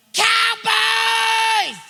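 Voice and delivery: put-on high, full-throated boyish yell; kids screaming. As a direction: scream it down the hotel hall like a rowdy kid. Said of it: "They scream in the hall".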